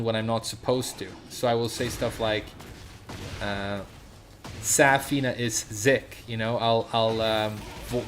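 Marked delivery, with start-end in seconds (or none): 4.6-6.2 "Zik" with the S buzzed into a Z; voiced S. Shown as a demonstration. Streamer showing their own speech slip, voiced S. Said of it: "I sometimes voice an S sound".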